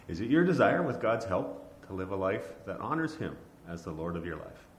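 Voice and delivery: normal talking voice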